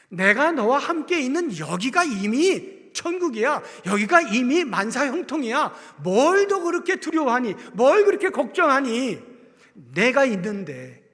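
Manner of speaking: preacher voicing Jesus reassuring the fearful; fervent reassurance. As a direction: pour out Jesus' comfort at full force.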